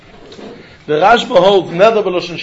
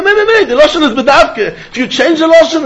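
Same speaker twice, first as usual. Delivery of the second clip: raised-pitch insistent rebuttal; emphatic objection; 6 semitones higher, 3 dB louder than usual. Acted. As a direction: cut in with an insistent correction, voice lifted. Acts the Ran's emphatic answer.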